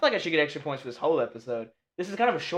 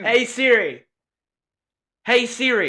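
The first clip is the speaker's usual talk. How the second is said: raised, higher, louder call; louder, insistent wake-up call; calling the voice assistant; 6 semitones higher, 4 dB louder than usual. Real, not acted. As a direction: call out to summon the phone.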